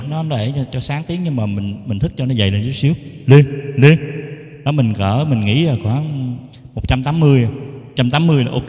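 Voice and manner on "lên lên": loud, firm test calls; two punchy sound-check calls into the mic. Shown as a demonstration. Teacher checking a thicker tone setting, loud mic-check call. Testing microphone at new setting.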